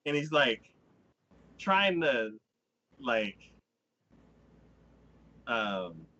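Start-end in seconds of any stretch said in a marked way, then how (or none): none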